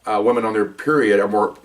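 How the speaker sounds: normal talking voice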